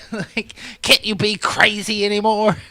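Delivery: high loud exasperated question; loud riff. Real, not acted. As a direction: push it out, caught up in the joke. Riffing loudly on the joke.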